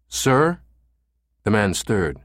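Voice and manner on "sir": raised, higher questioning call; a little louder. A narrator's reading. Becker rousing a sleeping old man, a little louder. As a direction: try to wake him, slightly louder. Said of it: "a little louder".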